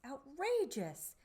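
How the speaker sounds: ordinary delivery, nothing marked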